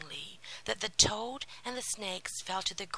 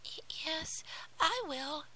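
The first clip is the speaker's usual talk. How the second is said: high, hushed and trembling; timid yes, voice shaking with fear; 12 semitones higher than usual. Acted. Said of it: "in a trembling voice".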